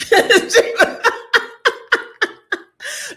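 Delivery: long, loud, high laughing fit; real laughter. Real, not acted. Vlogger recalling a woman who wouldn't let go, loud laughter. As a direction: crack up loudly at your own story.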